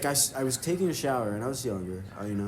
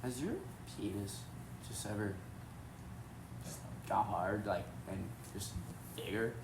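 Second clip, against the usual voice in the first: soft, halting secret question; hushed question; 5 semitones lower, 15 dB quieter than usual. Retold or imitated. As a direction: ask it under your breath in class. Secretly asking friend in class.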